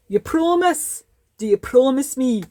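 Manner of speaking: high, loud, insistent Scottish voice; Scottish accent. Acted. Brassy makes the owner promise to finish, insistent Scottish accent. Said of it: "I'm talking in a Scottish accent".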